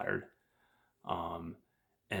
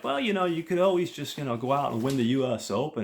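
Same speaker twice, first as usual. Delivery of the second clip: high, loud mock suggestion, dripping with sarcasm; sarcastic friend teasing the smitten golfer, mock encouragement; 6 semitones higher, 5 dB louder, faster than usual. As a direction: toss off a joking idea he doesn't mean. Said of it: "totally sarcastic, totally joking".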